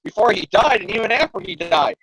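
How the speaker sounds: hammed-up soap-opera worry; worried Betty. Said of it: "she wonders".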